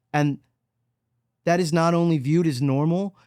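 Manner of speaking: ordinary delivery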